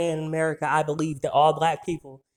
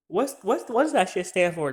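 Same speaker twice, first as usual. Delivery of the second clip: usual speaking voice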